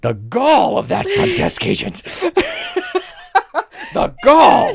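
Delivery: high, slow, hammed-up indignation; mock outrage. Guest fuming about the front desk agent, mock outrage. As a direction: imitate an affronted guest, barely holding back laughter.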